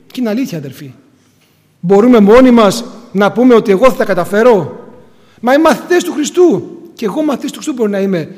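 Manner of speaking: ordinary delivery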